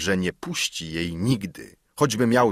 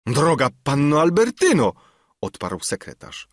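earnest higher-pitched plea from the secretary; pleading secretary; 6 semitones higher than usual. A narrator's reading. Secretary begs his fiancée to believe him, pleading. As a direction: plead with rising, swooping pitch.

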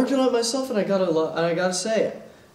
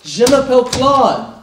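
loud over enunciating; 6 semitones higher, 11 dB louder than usual. Shown as a demonstration. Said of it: "Really listen".

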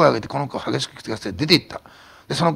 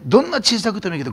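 normal talking voice

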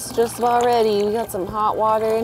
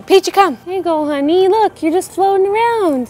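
talking to a pet; 7 semitones higher, 4 dB louder than usual. Real, not acted. Coaxing dog into pool.

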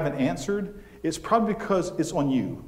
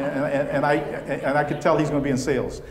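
normal talking voice

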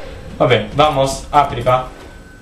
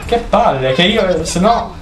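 ordinary talk, nothing marked

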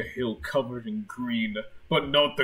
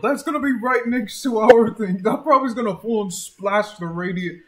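alarmed; 8 semitones higher, 9 dB louder than usual. Real, not acted. Alarmed about crash site.

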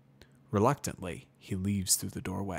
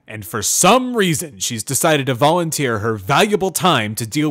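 loud, booming guard barking orders at prisoners; noisy guard; 5 semitones higher, 15 dB louder than usual. A narrator's reading. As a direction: boom it out like a stern jailer. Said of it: "the noisy guard".

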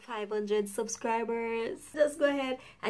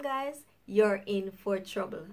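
ordinary delivery

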